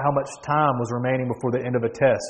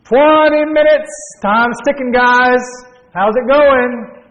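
loud, high, stretched-out hollering; barked time warning; 9 semitones higher, 7 dB louder, slower than usual. Acted. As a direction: pester the whole exam hall at full volume. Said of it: "barking out how much time was left".

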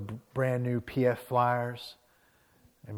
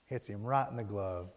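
ordinary delivery

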